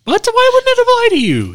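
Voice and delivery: high, disbelieving question; can't believe she said that; incredulous. Real, not acted. Husband arguing with his wife about the rule, incredulous high pitch.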